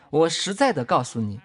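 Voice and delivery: ordinary delivery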